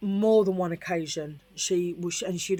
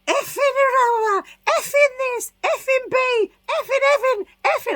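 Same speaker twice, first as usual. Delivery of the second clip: high, loud screeched swearing; squealing rant of effings, pitched way up; screaming swearing; 9 dB louder, slower than usual. Retold or imitated. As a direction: shriek the effing like a furious girl. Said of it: "And then I've heard".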